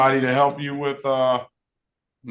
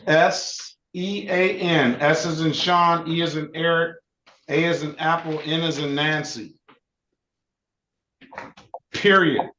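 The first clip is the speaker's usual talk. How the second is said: dictating. Shown as a demonstration. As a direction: spell each letter clearly for dictation. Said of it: "can you say it again".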